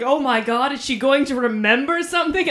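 loud and high, wide-eyed anticipation; eager, breathless worry; excited quote. Retold or imitated. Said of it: "he's like".

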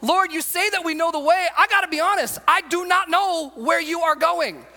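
Thomas pressing Jesus for answers; pleading Thomas. Acted. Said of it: "That's Thomas".

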